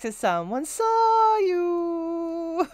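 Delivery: high drawn-out mocking wail. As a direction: stretch it out like a whiny alarm. Mimicking the game's alert.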